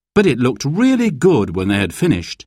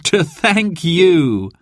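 slow, high, with a little laugh; 7 semitones higher, much slower than usual. A narrator's reading. Mum explains party to children, laughing. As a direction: laugh lightly into the drawn-out words. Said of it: "laughed Mum".

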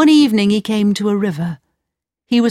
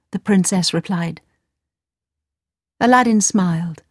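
usual speaking voice

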